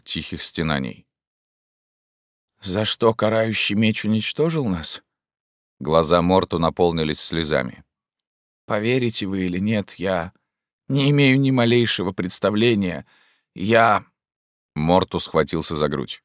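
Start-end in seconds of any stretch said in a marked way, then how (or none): 2.6-5.0 a hint of a weary ghostly man; lightly voiced otherworldly stranger. A narrator's reading. Morty speaks. 8.7-14.0 a hint of a weary ghostly man; lightly voiced otherworldly stranger. A narrator's reading. Morty speaks.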